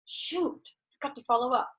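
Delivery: high, mock-dismayed ah shoot; caught out. Meeting a neighbour in the lobby, having forgotten to call: mock dismay. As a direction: act caught out forgetting a promise. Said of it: "I'll be like".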